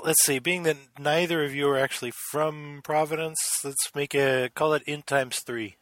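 normal talking voice

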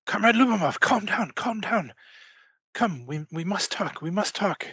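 high-pitched, hushed urgent character voice; urgent conspirator. Acted. Game master voices man grabbing player.

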